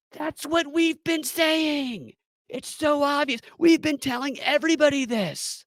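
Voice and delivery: excited agreement. Retold or imitated. Agents eagerly agreeing.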